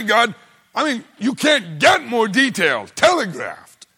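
loud, high, driving sermon; preacher hammering the point home; full-force preaching. Acted. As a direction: build it like a pulpit climax.

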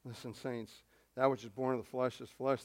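usual speaking voice

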